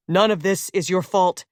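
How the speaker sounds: ordinary delivery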